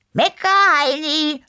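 high, loud yell back; shouted retort. A narrator's reading. Neighbour answering a complaint across the courtyard, shouted back. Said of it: "kam es zurück".

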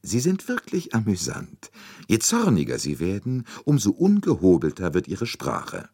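amused mockery. A narrator's reading. Poirot mocks angry Englishman.

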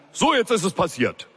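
high, shouted burst down the phone; bellowing boss. A narrator's reading. Boss bellowing down the phone without a greeting. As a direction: yell it straight into the line. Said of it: "brüllte er direkt durch die Leitung".

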